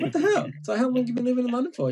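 high-pitched, incredulous retort; retold disbelief. Podcaster retells meeting a Perth woman with a London accent, incredulous.

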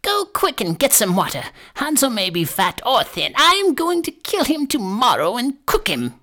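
high-pitched, impatient crone; impatient witch. A narrator's reading. Witch orders Gretel to get water, impatient. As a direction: order her about as a high-voiced witch out of patience. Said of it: "she lost patience and determined to wait no longer".

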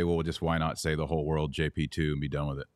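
ordinary delivery

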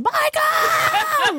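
high, drawn-out wail of a name; hammed-up high-pitched wail; imitated wailing yell. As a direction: cry out like a grieving mother. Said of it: "my mother's just yelling".

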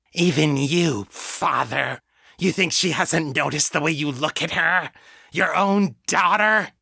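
high mocking faked demon; demonic taunt. A narrator's reading. Janet taunting her father in a faked demon voice. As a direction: needle the father with malice. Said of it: "voice was contorted with demonic malice".

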